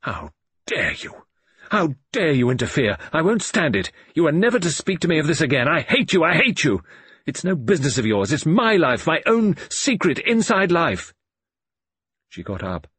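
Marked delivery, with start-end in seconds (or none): 0.0-1.2 slow, seething how dare you; cold fury. Acted. Daughter turns on her father, slow and seething. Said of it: "turned on me like an avenging fury". 1.7-11.1 loud, high, furious outburst; avenging fury. Acted. Daughter turns on her father in a loud, high fury. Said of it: "turned on me like an avenging fury".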